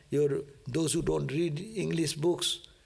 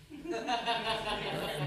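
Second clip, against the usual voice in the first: short soft laugh; 5 semitones higher, 5 dB quieter than usual. Real, not acted. Laughing at his own mock prayer.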